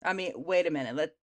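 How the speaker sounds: ordinary talk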